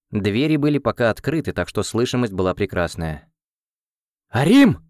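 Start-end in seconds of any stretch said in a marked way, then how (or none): 4.3-4.8 громкий резкий окрик, выше; рявкнуть по-командирски. Acted. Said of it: "прикрикнул я". (громкий командный окрик)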